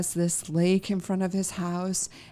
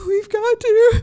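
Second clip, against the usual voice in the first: much higher, louder, laughing through tears; crying laugh; 11 dB louder, slower than usual. Retold or imitated. Unable to call the vet, laugh-crying. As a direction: choke it out between laugh and sob. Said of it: "And I was crying".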